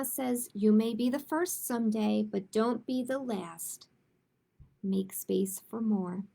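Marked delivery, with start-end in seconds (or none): none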